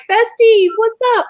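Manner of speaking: very high-pitched gushing hello; play-acted squeal to best pals; excited greeting. Mom greeting her celebrity friends, playfully excited. Imagines greeting the stars back.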